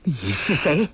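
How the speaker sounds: trembling voice. A narrator's reading. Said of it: "颤声问道".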